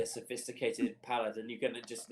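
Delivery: ordinary talk, nothing marked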